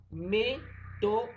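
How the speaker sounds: teacher's demo of splitting a word into syllables. Shown as a demonstration. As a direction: break método into me... to... do, each firmly set apart. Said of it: "dividimos método en tres sílabas".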